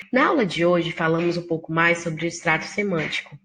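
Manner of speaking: usual speaking voice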